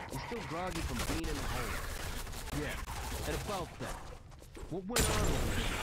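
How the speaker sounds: indignant, pushy challenge; angry confrontation. A narrator's reading. Owen confronts T J, jabbing finger.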